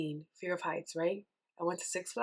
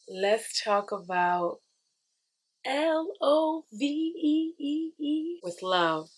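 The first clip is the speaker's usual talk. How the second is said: slow, drawn out and higher, letter by letter; spelled out; 8 semitones higher, much slower than usual. Shown as a demonstration. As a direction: spell L O V E slowly like a headline. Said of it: "spells out love slowly".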